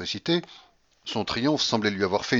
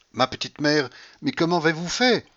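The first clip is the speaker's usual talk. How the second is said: high, louder servant's wonder; amazed exclamation; 7 semitones higher, 5 dB louder than usual. A narrator's reading. Servant Marfa marvels at her mistress's win, amazed. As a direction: exclaim in astonishment. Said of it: "s'exclamait Marfa".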